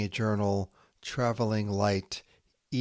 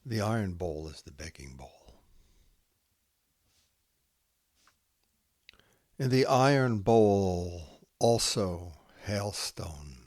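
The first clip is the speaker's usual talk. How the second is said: slow, hushed haiku recital with long pauses; poem reading; 3 semitones higher, slower than usual. Acted. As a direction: let each haiku settle into silence. Reads Santoka haiku aloud.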